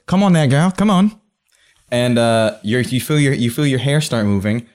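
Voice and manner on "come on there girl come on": high coaxing call; a hint of a folksy handler. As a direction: call a pet spider to you. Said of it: "he goes".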